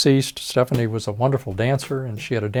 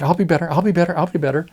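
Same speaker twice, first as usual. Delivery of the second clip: higher, louder pleading promise; 7 semitones higher, 5 dB louder, faster than usual. Retold or imitated. Chastened patient pleading with the doctor, higher and louder. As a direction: beg to be forgiven, repeating it fast. Patient begging after the scolding.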